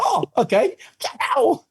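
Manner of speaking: very high, tentative mock farewell; bewildered goodbye. Shooter let off by the cop, high bewildered imitation. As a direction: squeak out a baffled retold sign-off.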